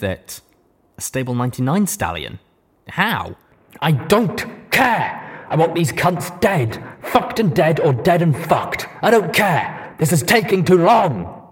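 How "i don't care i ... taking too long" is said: loud, strained furious ranting; raging almost in pain. A narrator's reading. Deus ranting furiously at the stallion. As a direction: rage like it hurts. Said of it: "he said almost in pain".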